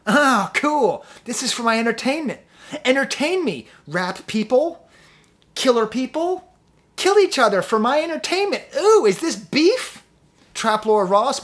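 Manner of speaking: high, loud mock delight; sarcastic glee. Real, not acted. Mocking fans who enjoy rap beef, high and loud sarcasm. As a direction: sneer like a bloodthirsty fan.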